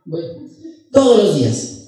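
ordinary delivery

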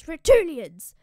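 loud battlefield shout. Acted. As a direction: call the army with a full shout. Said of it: "and he shouts".

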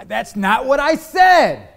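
loud, high, drawn-out argument shout; slow, exasperated shout with pitch jumping up. Acted. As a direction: raise your voice at a spouse who isn't hearing you. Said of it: "you raise your voice a little bit".

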